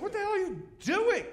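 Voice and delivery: high, loud shocked yell; high-pitched shocked shout; mock yell. Teller yells at smoking.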